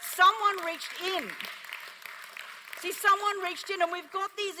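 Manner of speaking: loud, high, slowed preaching cry; preaching climax. Acted. Preacher driving home the point: fiery. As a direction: proclaim it at full force.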